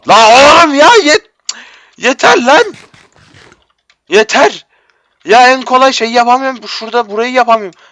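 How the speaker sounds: high, loud wail of frustration; whining shout of enough; frustrated wail. Real, not acted. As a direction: cry out, fed up with failing. Yet another failed jump.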